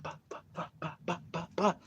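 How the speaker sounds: soft whispered mock punch sounds; punch noises. Teller acting out the fight at the dance with soft punch noises. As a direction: patter out the punches under your breath.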